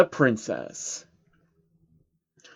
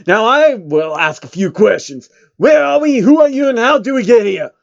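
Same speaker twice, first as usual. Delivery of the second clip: loud, high hype-man shout; 7 semitones higher, 7 dB louder than usual. Acted. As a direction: bark the questions like a rapper on the mic. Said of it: "The one with braids answered".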